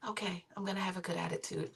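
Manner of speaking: very soft imitation of a yielding teen; meek giving-in. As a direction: murmur it like a daughter reluctantly agreeing. Daughter giving in, retold.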